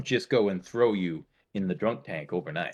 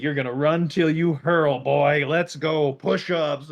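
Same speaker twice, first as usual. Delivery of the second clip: higher, louder mock drill orders; hammed-up drill sergeant; 5 semitones higher, 5 dB louder than usual. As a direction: bark at the drunk like a boot camp sergeant. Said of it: "they're like".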